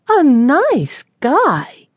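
very slow, high scoffing question; disbelief. A narrator's reading. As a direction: drag it out, incredulous. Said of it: "asked in disbelief".

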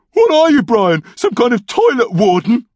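loud, high sarcastic jibe; irritated mockery. A narrator's reading. Norm mocking his brother through the door, irritated.

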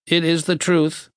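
high, monotone box voice; machine verdict. A narrator's reading. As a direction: pronounce it like a machine. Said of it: "the box said".

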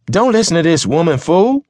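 high, loud, rushed order; angry shout. Acted. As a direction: shout the driver down, fast. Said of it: "Mister Barron shouted".

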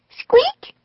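tiny, very high squeak; mouse squeak. A narrator's reading. Peanut the mouse scared in shaking treehouse, high squeak. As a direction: squeak like a frightened little mouse.

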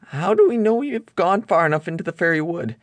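nervous first mate asking anxiously; Smee voice. A narrator's reading.

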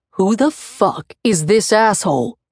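man growling a challenge. A narrator's reading. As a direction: growl it out, jealous and hostile. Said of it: "he growls".

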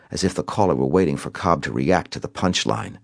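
ordinary delivery

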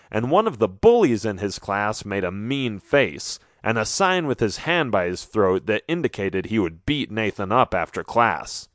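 ordinary delivery

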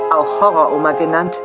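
ordinary talk, nothing marked

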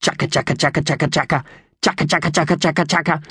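high, rattling bird chatter; two magpies chattering crossly, rising in pitch; angry magpie chatter. A narrator's reading. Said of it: "began chattering at one another angrily".

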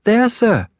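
put-on very high, timid child; high-pitched nervous reply; scared little boy. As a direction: answer in a small frightened boy's voice. Frightened boy answers convict.

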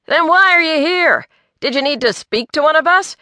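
high, sharp challenge, quickening at the end; rather sharp. A narrator's reading. Pete challenges Earl's presence, rather sharply. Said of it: "Pete asked rather sharply".

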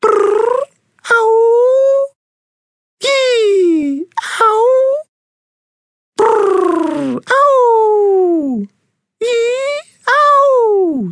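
very high, loud yowling cats; cat caterwaul. Acted. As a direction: yowl like a fighting cat chorus. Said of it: "a caterwaul symphony".

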